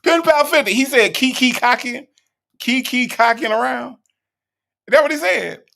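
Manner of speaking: high, loud, incredulous outburst; amused disbelief. Real, not acted. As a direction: call it out loudly in disbelief. Incredulous, cracking up over misheard words.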